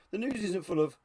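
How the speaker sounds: ordinary talk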